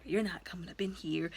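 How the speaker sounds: hushed, breathy threat under the breath; whispered warning. Retold or imitated. Wife warning the intruder off, in a whisper.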